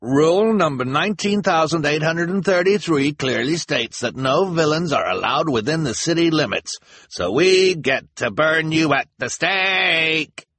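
high, smug rule-reciting; gloating Lesko. A narrator's reading. Mister Lesko gloating over the rule, smug. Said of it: "Mister Lesko called out triumphantly".

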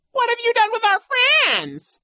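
very high, squealed mock echo; loud, jeering child imitation; mocking mimicry. A narrator's reading. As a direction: parrot it back in a shrieking falsetto. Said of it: "said in his mocking tone".

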